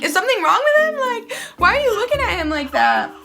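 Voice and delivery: high, anxious questioning; worried alarm. Retold or imitated. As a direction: panicky voice, asking if the baby is okay. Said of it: "'cause I'm like".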